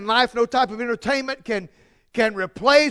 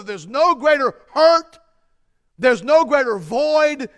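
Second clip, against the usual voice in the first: slow emphatic preaching; 2 semitones higher, 5 dB louder, slower than usual. Acted. Preaching climax.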